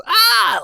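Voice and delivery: sudden high yelp of alarm; mock scream. Ace caught out mid-sentence, a high yelp. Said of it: "he's screaming".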